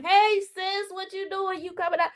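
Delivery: high, soft mock neighbour calling; imitated pleading caller. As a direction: lift it high and lighter, mimicking a caller.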